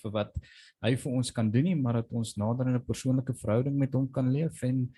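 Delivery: normal talking voice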